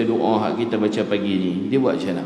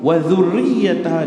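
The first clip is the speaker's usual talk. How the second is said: higher, louder chanted phrase; raised recited Arabic; Quranic recitation; 6 semitones higher, 6 dB louder than usual. Acted. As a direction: sing the phrase out slowly.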